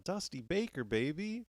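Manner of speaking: normal talking voice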